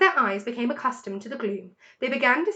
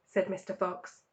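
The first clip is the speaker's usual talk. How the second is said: plain reading voice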